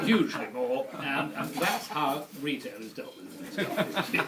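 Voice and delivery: laughing. Real, not acted. Laughing through the punchline.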